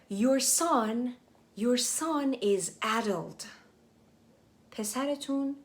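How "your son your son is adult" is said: slow reading. Shown as a demonstration. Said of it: "slow English read-aloud for learners".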